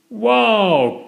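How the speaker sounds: high, long falling "wow"; awed gasp. A narrator's reading. Peppa and George seeing the helicopter, awed gasp. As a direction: gasp with wide wonder. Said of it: "gasped Peppa and George".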